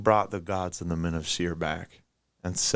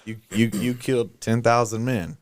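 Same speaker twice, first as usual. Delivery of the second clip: usual speaking voice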